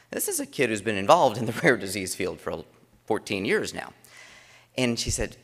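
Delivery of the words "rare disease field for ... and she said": ordinary delivery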